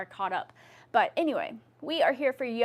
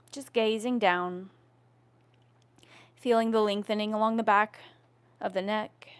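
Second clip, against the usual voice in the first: yoga instruction. Acted. Leads yoga neck movements.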